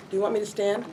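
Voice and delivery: normal talking voice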